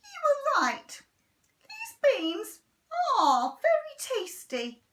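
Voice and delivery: high, loud sugary praise; Henry faking praise to Mum; fake sweetness. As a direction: lay it on thick. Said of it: "pretending to chew".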